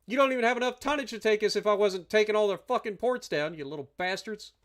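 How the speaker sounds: ordinary talk, nothing marked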